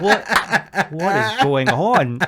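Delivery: high, loud disbelief, laughing; incredulous. Retold or imitated. Comic recalling being baffled by own retort, incredulous.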